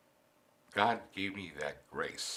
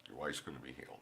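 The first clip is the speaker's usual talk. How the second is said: soft, low and hushed; a gentle hush of a divine voice; hushed voice of God; 8 semitones lower, 14 dB quieter, faster than usual. Retold or imitated. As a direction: say it like a quiet inner voice. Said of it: "I heard that voice".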